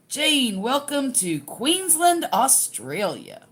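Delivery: attempted Australian accent. Host greeting Jane as if from Queensland, in a bad Australian accent. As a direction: welcome her the way an Aussie might, and don't quite manage it. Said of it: "I, I'm not good at that".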